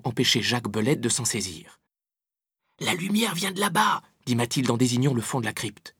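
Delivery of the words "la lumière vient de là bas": put-on high-pitched girl pointing the way; Mathilde's voice. A narrator's reading. Girl character voiced by narrator.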